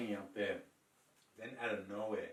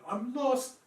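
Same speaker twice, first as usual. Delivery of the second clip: high, loud, hammed-up wail; put-on panicky sobbing voice; mock crying; 14 semitones higher, 15 dB louder, slower than usual. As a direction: imitate a helpless woman wailing down the phone. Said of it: "she's crying on the phone".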